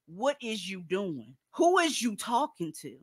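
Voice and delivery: a slow, hushed mocking mimic saying "What is you doing?"; mocking impression. Host mocks women who say "What is you doing?", hushed and slow. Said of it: "when you say".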